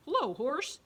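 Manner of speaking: high, loud chirpy duck voice; bright, loud duck hello; cheery duck greeting. Acted. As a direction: greet the cow like an excited little duck.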